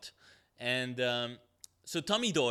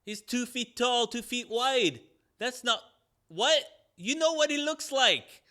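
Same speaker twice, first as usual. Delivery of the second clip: loud, much higher mock exasperation; exasperated; 9 semitones higher, 6 dB louder than usual. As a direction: yell out the obvious in disbelief. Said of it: "And she's like".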